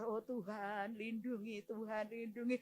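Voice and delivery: soft, hushed plea for protection; retold trembling prayer. Frightened visitor praying while breaking bad news, hushed. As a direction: murmur a frightened prayer under the words. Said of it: "sambil gemetar".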